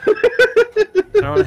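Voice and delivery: high squeal through laughter; words swallowed by a laugh. Real, not acted. Breaks up laughing mid-sentence.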